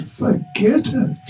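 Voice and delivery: high, slow sulky brush-off; sulky refusal. Acted. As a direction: refuse him like a moody child. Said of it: "He gets into a mood".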